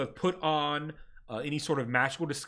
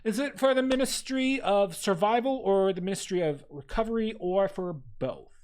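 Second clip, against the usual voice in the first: higher, prim bureaucratic clerk voice; desk clerk; 4 semitones higher than usual. Acted. Ministry clerk greets the ragged party, primly bureaucratic. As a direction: recite the rules like a tired official.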